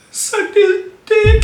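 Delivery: high, slow, whiny mock crying. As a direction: mimic her fake sobbing. Retelling her confession, mocking.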